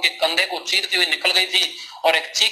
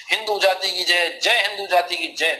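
plain reading voice

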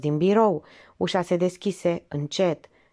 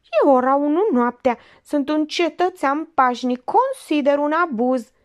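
high, loud squeaky protest of a fake-outraged man; shrill indignant outburst; 9 semitones higher, 5 dB louder than usual. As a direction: burst out high-pitched and offended. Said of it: "izbucni pițigăiat".

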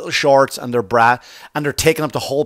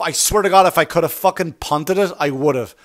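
usual speaking voice

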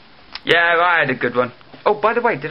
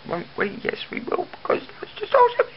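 much higher, fast hyperactive squeak; sudden hyper squeaky voice; silly hyper voice; 3 dB quieter, faster than usual. Acted. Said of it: "why I'm doing it in that voice".

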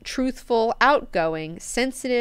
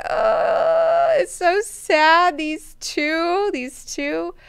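high, drawn-out mock wail; hammed-up sobbing lament; mock crying; 9 semitones higher, 4 dB louder, much slower than usual. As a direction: wail it slowly as if in tears. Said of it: "And she starts crying".

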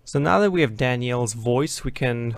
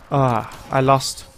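usual speaking voice

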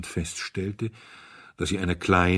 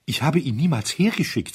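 higher and louder, anguished; distressed; 7 semitones higher, 6 dB louder than usual. A narrator's reading. Owner pleading anxiously with his guests, distressed. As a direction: wring it out in distress. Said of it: "rang gequält die Hände".

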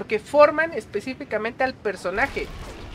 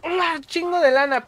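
higher, stunned exclamation at the cost; shocked at the price; 7 semitones higher, slower than usual. Retold or imitated. Recalling the ticket price, stunned and higher. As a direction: gasp at how expensive it is. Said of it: "Y yo así de".

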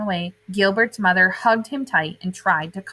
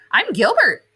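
high, louder bright hello; friendly intro; 7 semitones higher, 5 dB louder than usual. Acted. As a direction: introduce yourself shyly happy. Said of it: "said Gilbert".

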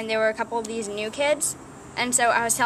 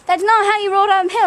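loud lecturing; 10 semitones higher, 9 dB louder than usual. Acted. Lecturing people loudly.

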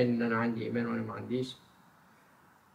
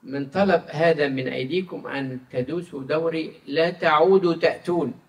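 slow, louder and raised reading of God's words; solemn scripture reading; 4 semitones higher, 8 dB louder than usual. Acted. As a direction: proclaim the verse slowly, weightily. Reads Isaiah aloud in sermon. (قراءة كتابية مهيبة ببطء)

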